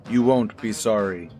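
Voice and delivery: ordinary delivery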